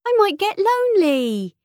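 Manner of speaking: put-on very high, little-girl plea; plaintive daughter. A narrator's reading. Daughter justifying her cuddly toys, high plaintive voice. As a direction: plead in a high girlish voice.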